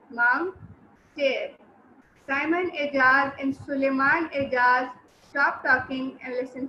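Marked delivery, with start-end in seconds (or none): 0.1-1.5 "Mom" and "stair" as separate beats; two syllables. Shown as a demonstration. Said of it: "it's having two syllables".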